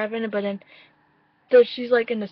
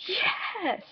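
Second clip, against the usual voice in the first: much higher, breathy-soft cheer; excited yes; 9 semitones higher, 5 dB quieter than usual. Retold or imitated. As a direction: squeak it out delighted.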